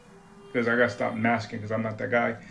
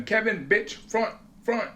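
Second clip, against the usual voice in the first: much higher, urgent nagging call; pushy demand; 9 semitones higher than usual. Retold or imitated. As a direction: badger her to take over. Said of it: "Same thing with Kevin".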